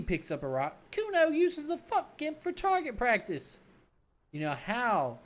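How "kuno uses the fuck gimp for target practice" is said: high, slow, sneering brat voice; bratty kid Kuno. Acted. Cocky kid mocking the cop, in a high bratty voice.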